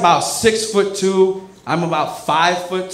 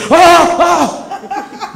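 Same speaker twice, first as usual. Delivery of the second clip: mock alarmed cries; 11 semitones higher, 7 dB louder than usual. Crowd rushing for seats.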